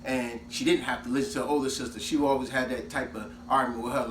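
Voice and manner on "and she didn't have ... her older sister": ordinary talk, nothing marked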